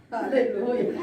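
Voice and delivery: normal talking voice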